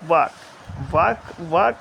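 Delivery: Work set apart, dropping down; fall on work. Shown as a demonstration. Teacher isolating the second syllable, work falling. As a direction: let each work fall away. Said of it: "बोलते ही उनका एक fall है".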